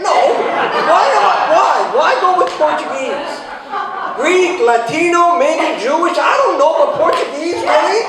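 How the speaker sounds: high, loud comic outrage with laughs slipping in; indignant rant. Retold or imitated. Italian called Portuguese by his doctor, loud mock outrage. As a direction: rant like you've been mortally insulted. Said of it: "I'm like".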